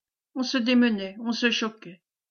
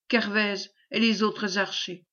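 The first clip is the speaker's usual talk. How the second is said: plain reading voice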